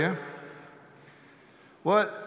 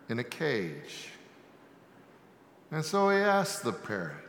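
ordinary talk, nothing marked

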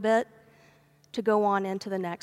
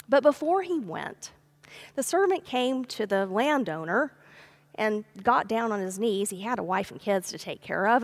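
ordinary talk

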